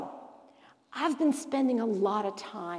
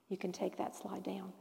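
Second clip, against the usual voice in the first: whispered aside off the mic; hushed word to the tech team; quiet unvoiced instruction; 3 semitones lower, 13 dB quieter than usual. Real, not acted.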